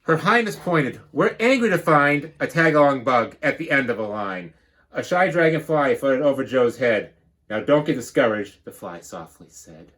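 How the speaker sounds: lively storybook read-aloud; bouncy rhyming storybook narration; reading to children. Acted. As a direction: read it like a picture book to the class. Teacher reads picture book.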